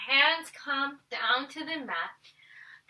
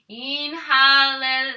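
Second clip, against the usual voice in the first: drawn-out inhale; 9 dB louder, much slower than usual. Real, not acted. Teacher cues breath.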